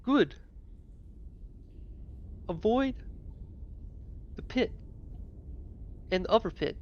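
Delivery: high, lightly voiced walrus; a first rough try at a walrus. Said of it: "I don't like that voice".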